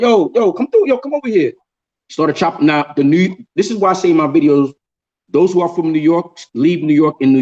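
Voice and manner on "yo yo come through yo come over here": high-pitched imitated hail; retold calling over. Costa Ricans beckoning him to join them, imitated high calling-out. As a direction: wave someone over with a raised, calling pitch.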